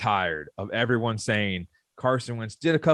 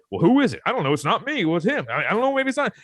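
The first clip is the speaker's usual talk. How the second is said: higher, squeaky mock coaches passing blame; 7 semitones higher, faster than usual. Fan imitating coaches in the Spider-Man meme, higher and squeaky. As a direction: play each coach pointing fingers. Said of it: "all the coaches say".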